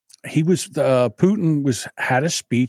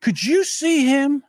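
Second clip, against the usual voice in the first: high, slow disbelieving drawl; mock incredulous; 10 semitones higher, slower than usual. Mocking the president's age to cohost, slow and high.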